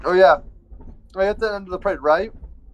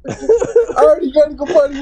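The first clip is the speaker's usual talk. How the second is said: laughing; 6 semitones higher, 3 dB louder, faster than usual. Real, not acted. Laughing fit mid-story.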